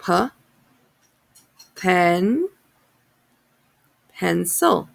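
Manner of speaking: pencil built up: P, pen, pencil; sounding out words. Shown as a demonstration. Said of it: "So let's sound it out".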